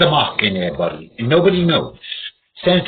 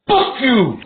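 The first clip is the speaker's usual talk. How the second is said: high, loud "Fuck you!" like a punch; yell; 10 semitones higher, 3 dB louder than usual. Shown as a demonstration. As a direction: spit it out like a nut. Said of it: "And go".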